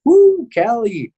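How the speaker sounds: naive thrill about the trip, bursting into a cheer at the end; retold excitement. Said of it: "I was excited. I'm like".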